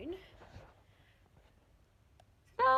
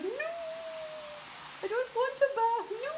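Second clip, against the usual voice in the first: high whiny dog voice, higher; dog's voice. Retold or imitated. Owner voicing her dog, high and whiny. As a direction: voice the dog refusing a bath, high. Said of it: "She's like".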